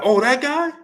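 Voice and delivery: high, lightly surprised mock Manny; imitating Manny. Host mimics Manny remembering an old foe, high mock voice. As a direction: lift it up like a faint memory.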